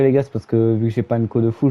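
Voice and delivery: ordinary talk, nothing marked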